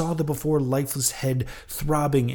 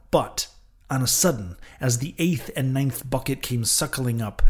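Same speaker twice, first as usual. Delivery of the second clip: plain reading voice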